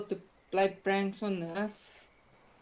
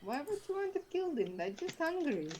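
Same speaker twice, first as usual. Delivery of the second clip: innocent pleading; 9 semitones higher, 5 dB quieter than usual. Acted. Pleading for the rodents.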